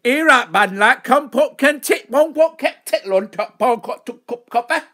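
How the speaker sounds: put-on loud, high gibberish Yorkshire accent; mock Yorkshire accent. Host giving their best Yorkshire accent on request, loud caricature. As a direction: hammer out nonsense syllables in a caricature Yorkshire accent. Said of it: "give us your best Yorkshire accent".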